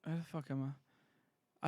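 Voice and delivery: ordinary delivery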